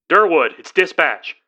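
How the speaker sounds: lightly voiced dispatcher; dispatch voice on phone. A narrator's reading. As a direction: dispatcher asking about the lost signal. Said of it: "the voice on the phone asked".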